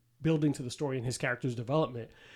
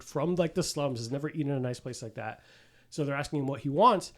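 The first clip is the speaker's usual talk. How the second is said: ordinary talk, nothing marked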